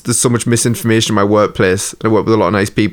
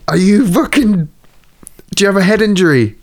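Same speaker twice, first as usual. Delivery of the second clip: exasperated retort; 7 semitones higher than usual. Retold or imitated. Imagined exasperated retort to coworker.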